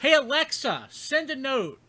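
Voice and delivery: high, put-on voice-assistant command; mock Alexa command. Host mock-commanding Alexa to send a note, high and put-on. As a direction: bark it at the smart speaker.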